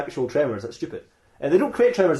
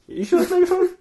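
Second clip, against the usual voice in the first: very high, doubting question; sceptical double-check. Acted. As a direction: query it with pitch shooting right up. Doubting the robot's identification.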